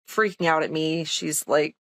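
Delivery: ordinary delivery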